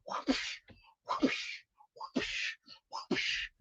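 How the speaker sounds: soft voiceless whooshes; whooshing noises. Pretend. Playing cowboy for Tennessee, imitated whooshes. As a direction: mimic a swish with breath alone, evenly spaced.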